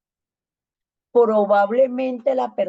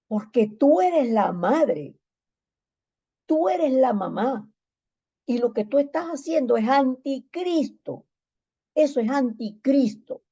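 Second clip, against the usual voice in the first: stern rebuke; 5 semitones higher than usual. Retold or imitated. Pastor rebuking the sister.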